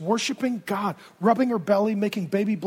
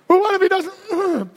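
mock anxious whine; 9 semitones higher, 5 dB louder than usual. Zechariah still worrying.

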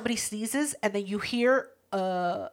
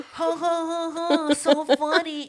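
higher, sarcastic fake laugh; mock laughter; 6 semitones higher, slower than usual. Answering the year-end office joke, mock laughter. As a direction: fake being tickled by a bad joke. Mocking coworkers' see-you-next-year joke.

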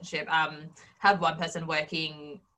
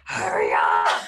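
a low, loud laughing yell; mock laughing yell; 13 semitones lower, 8 dB louder, much slower than usual. Girlfriend yelling at boyfriend to hurry, laughing. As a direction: bark it at him through laughter.

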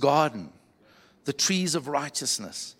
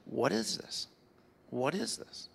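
soft, raised voice of wonder; hushed bewilderment; 3 semitones higher, 8 dB quieter, slower than usual. Acted. Scientists staring at a rainbow at night, hushed bewilderment. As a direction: ask it softly, as if baffled by the sight. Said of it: "they're all looking at it and going like".

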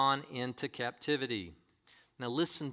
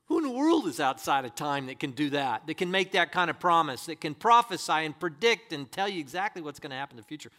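ordinary delivery

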